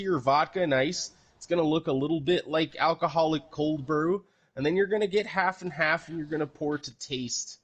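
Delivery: Canadian bartender voice, eh; Canadian accent. Pretend. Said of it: "you gotta do it in Russian accent".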